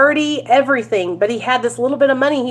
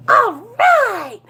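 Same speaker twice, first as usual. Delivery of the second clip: high-pitched celebratory cheer; retold burst of delight, pitched way up; excited; 11 semitones higher than usual. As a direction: let out a drawn, thrilled cry. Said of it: "I was so excited".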